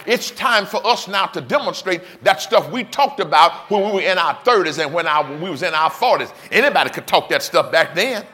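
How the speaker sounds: loud, driving preacher's cadence; full-force preaching. Acted. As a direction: preach it out with rising fire.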